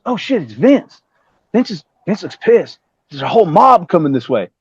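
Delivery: high-pitched imitation of his Georgia friend; whistling talker. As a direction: whistle through the words, sounding alarmed. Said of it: "he had this whistle when he talked".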